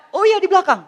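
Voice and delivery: high, loud realisation; acted neighbour. Neighbour recognising the medicine seller's house, high acted recognition. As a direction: pitch it up as someone suddenly remembering.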